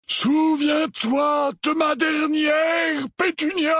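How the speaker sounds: high, slow, resounding shout; terrifying voice. A narrator's reading. Howler letter thundering at Aunt Petunia, terrifying voice. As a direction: boom out each word, long drawn out. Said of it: "Une voix terrifiante s'éleva".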